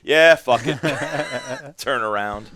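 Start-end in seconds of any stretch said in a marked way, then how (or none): 0.0-0.8 much louder, laughing shout; gleeful whatever; laughing impulse. Retold or imitated. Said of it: "and gone". 0.8-1.7 shrill burst of laughter; high cackle. Real, not acted. Laugh after gun-shop story.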